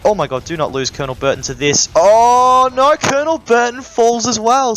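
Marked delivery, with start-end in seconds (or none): 2.0-4.8 high, loud alarmed cry of commentary; excited shout. Real, not acted. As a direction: yell it like the game just turned. Caster reacts to hero falling.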